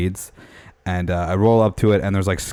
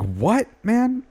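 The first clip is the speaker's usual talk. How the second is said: high-pitched retold exasperation; exasperated; 14 semitones higher than usual. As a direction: re-enact being fed up, voice shooting up. Retold fed-up reply to baiting.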